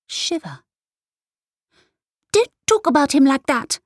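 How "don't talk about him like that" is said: put-on high, loud, fast child's shout. A narrator's reading. Girl shouting at her mother to defend her father. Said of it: "Kaina shouted".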